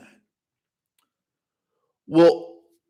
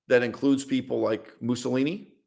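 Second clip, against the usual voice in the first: ordinary talk, nothing marked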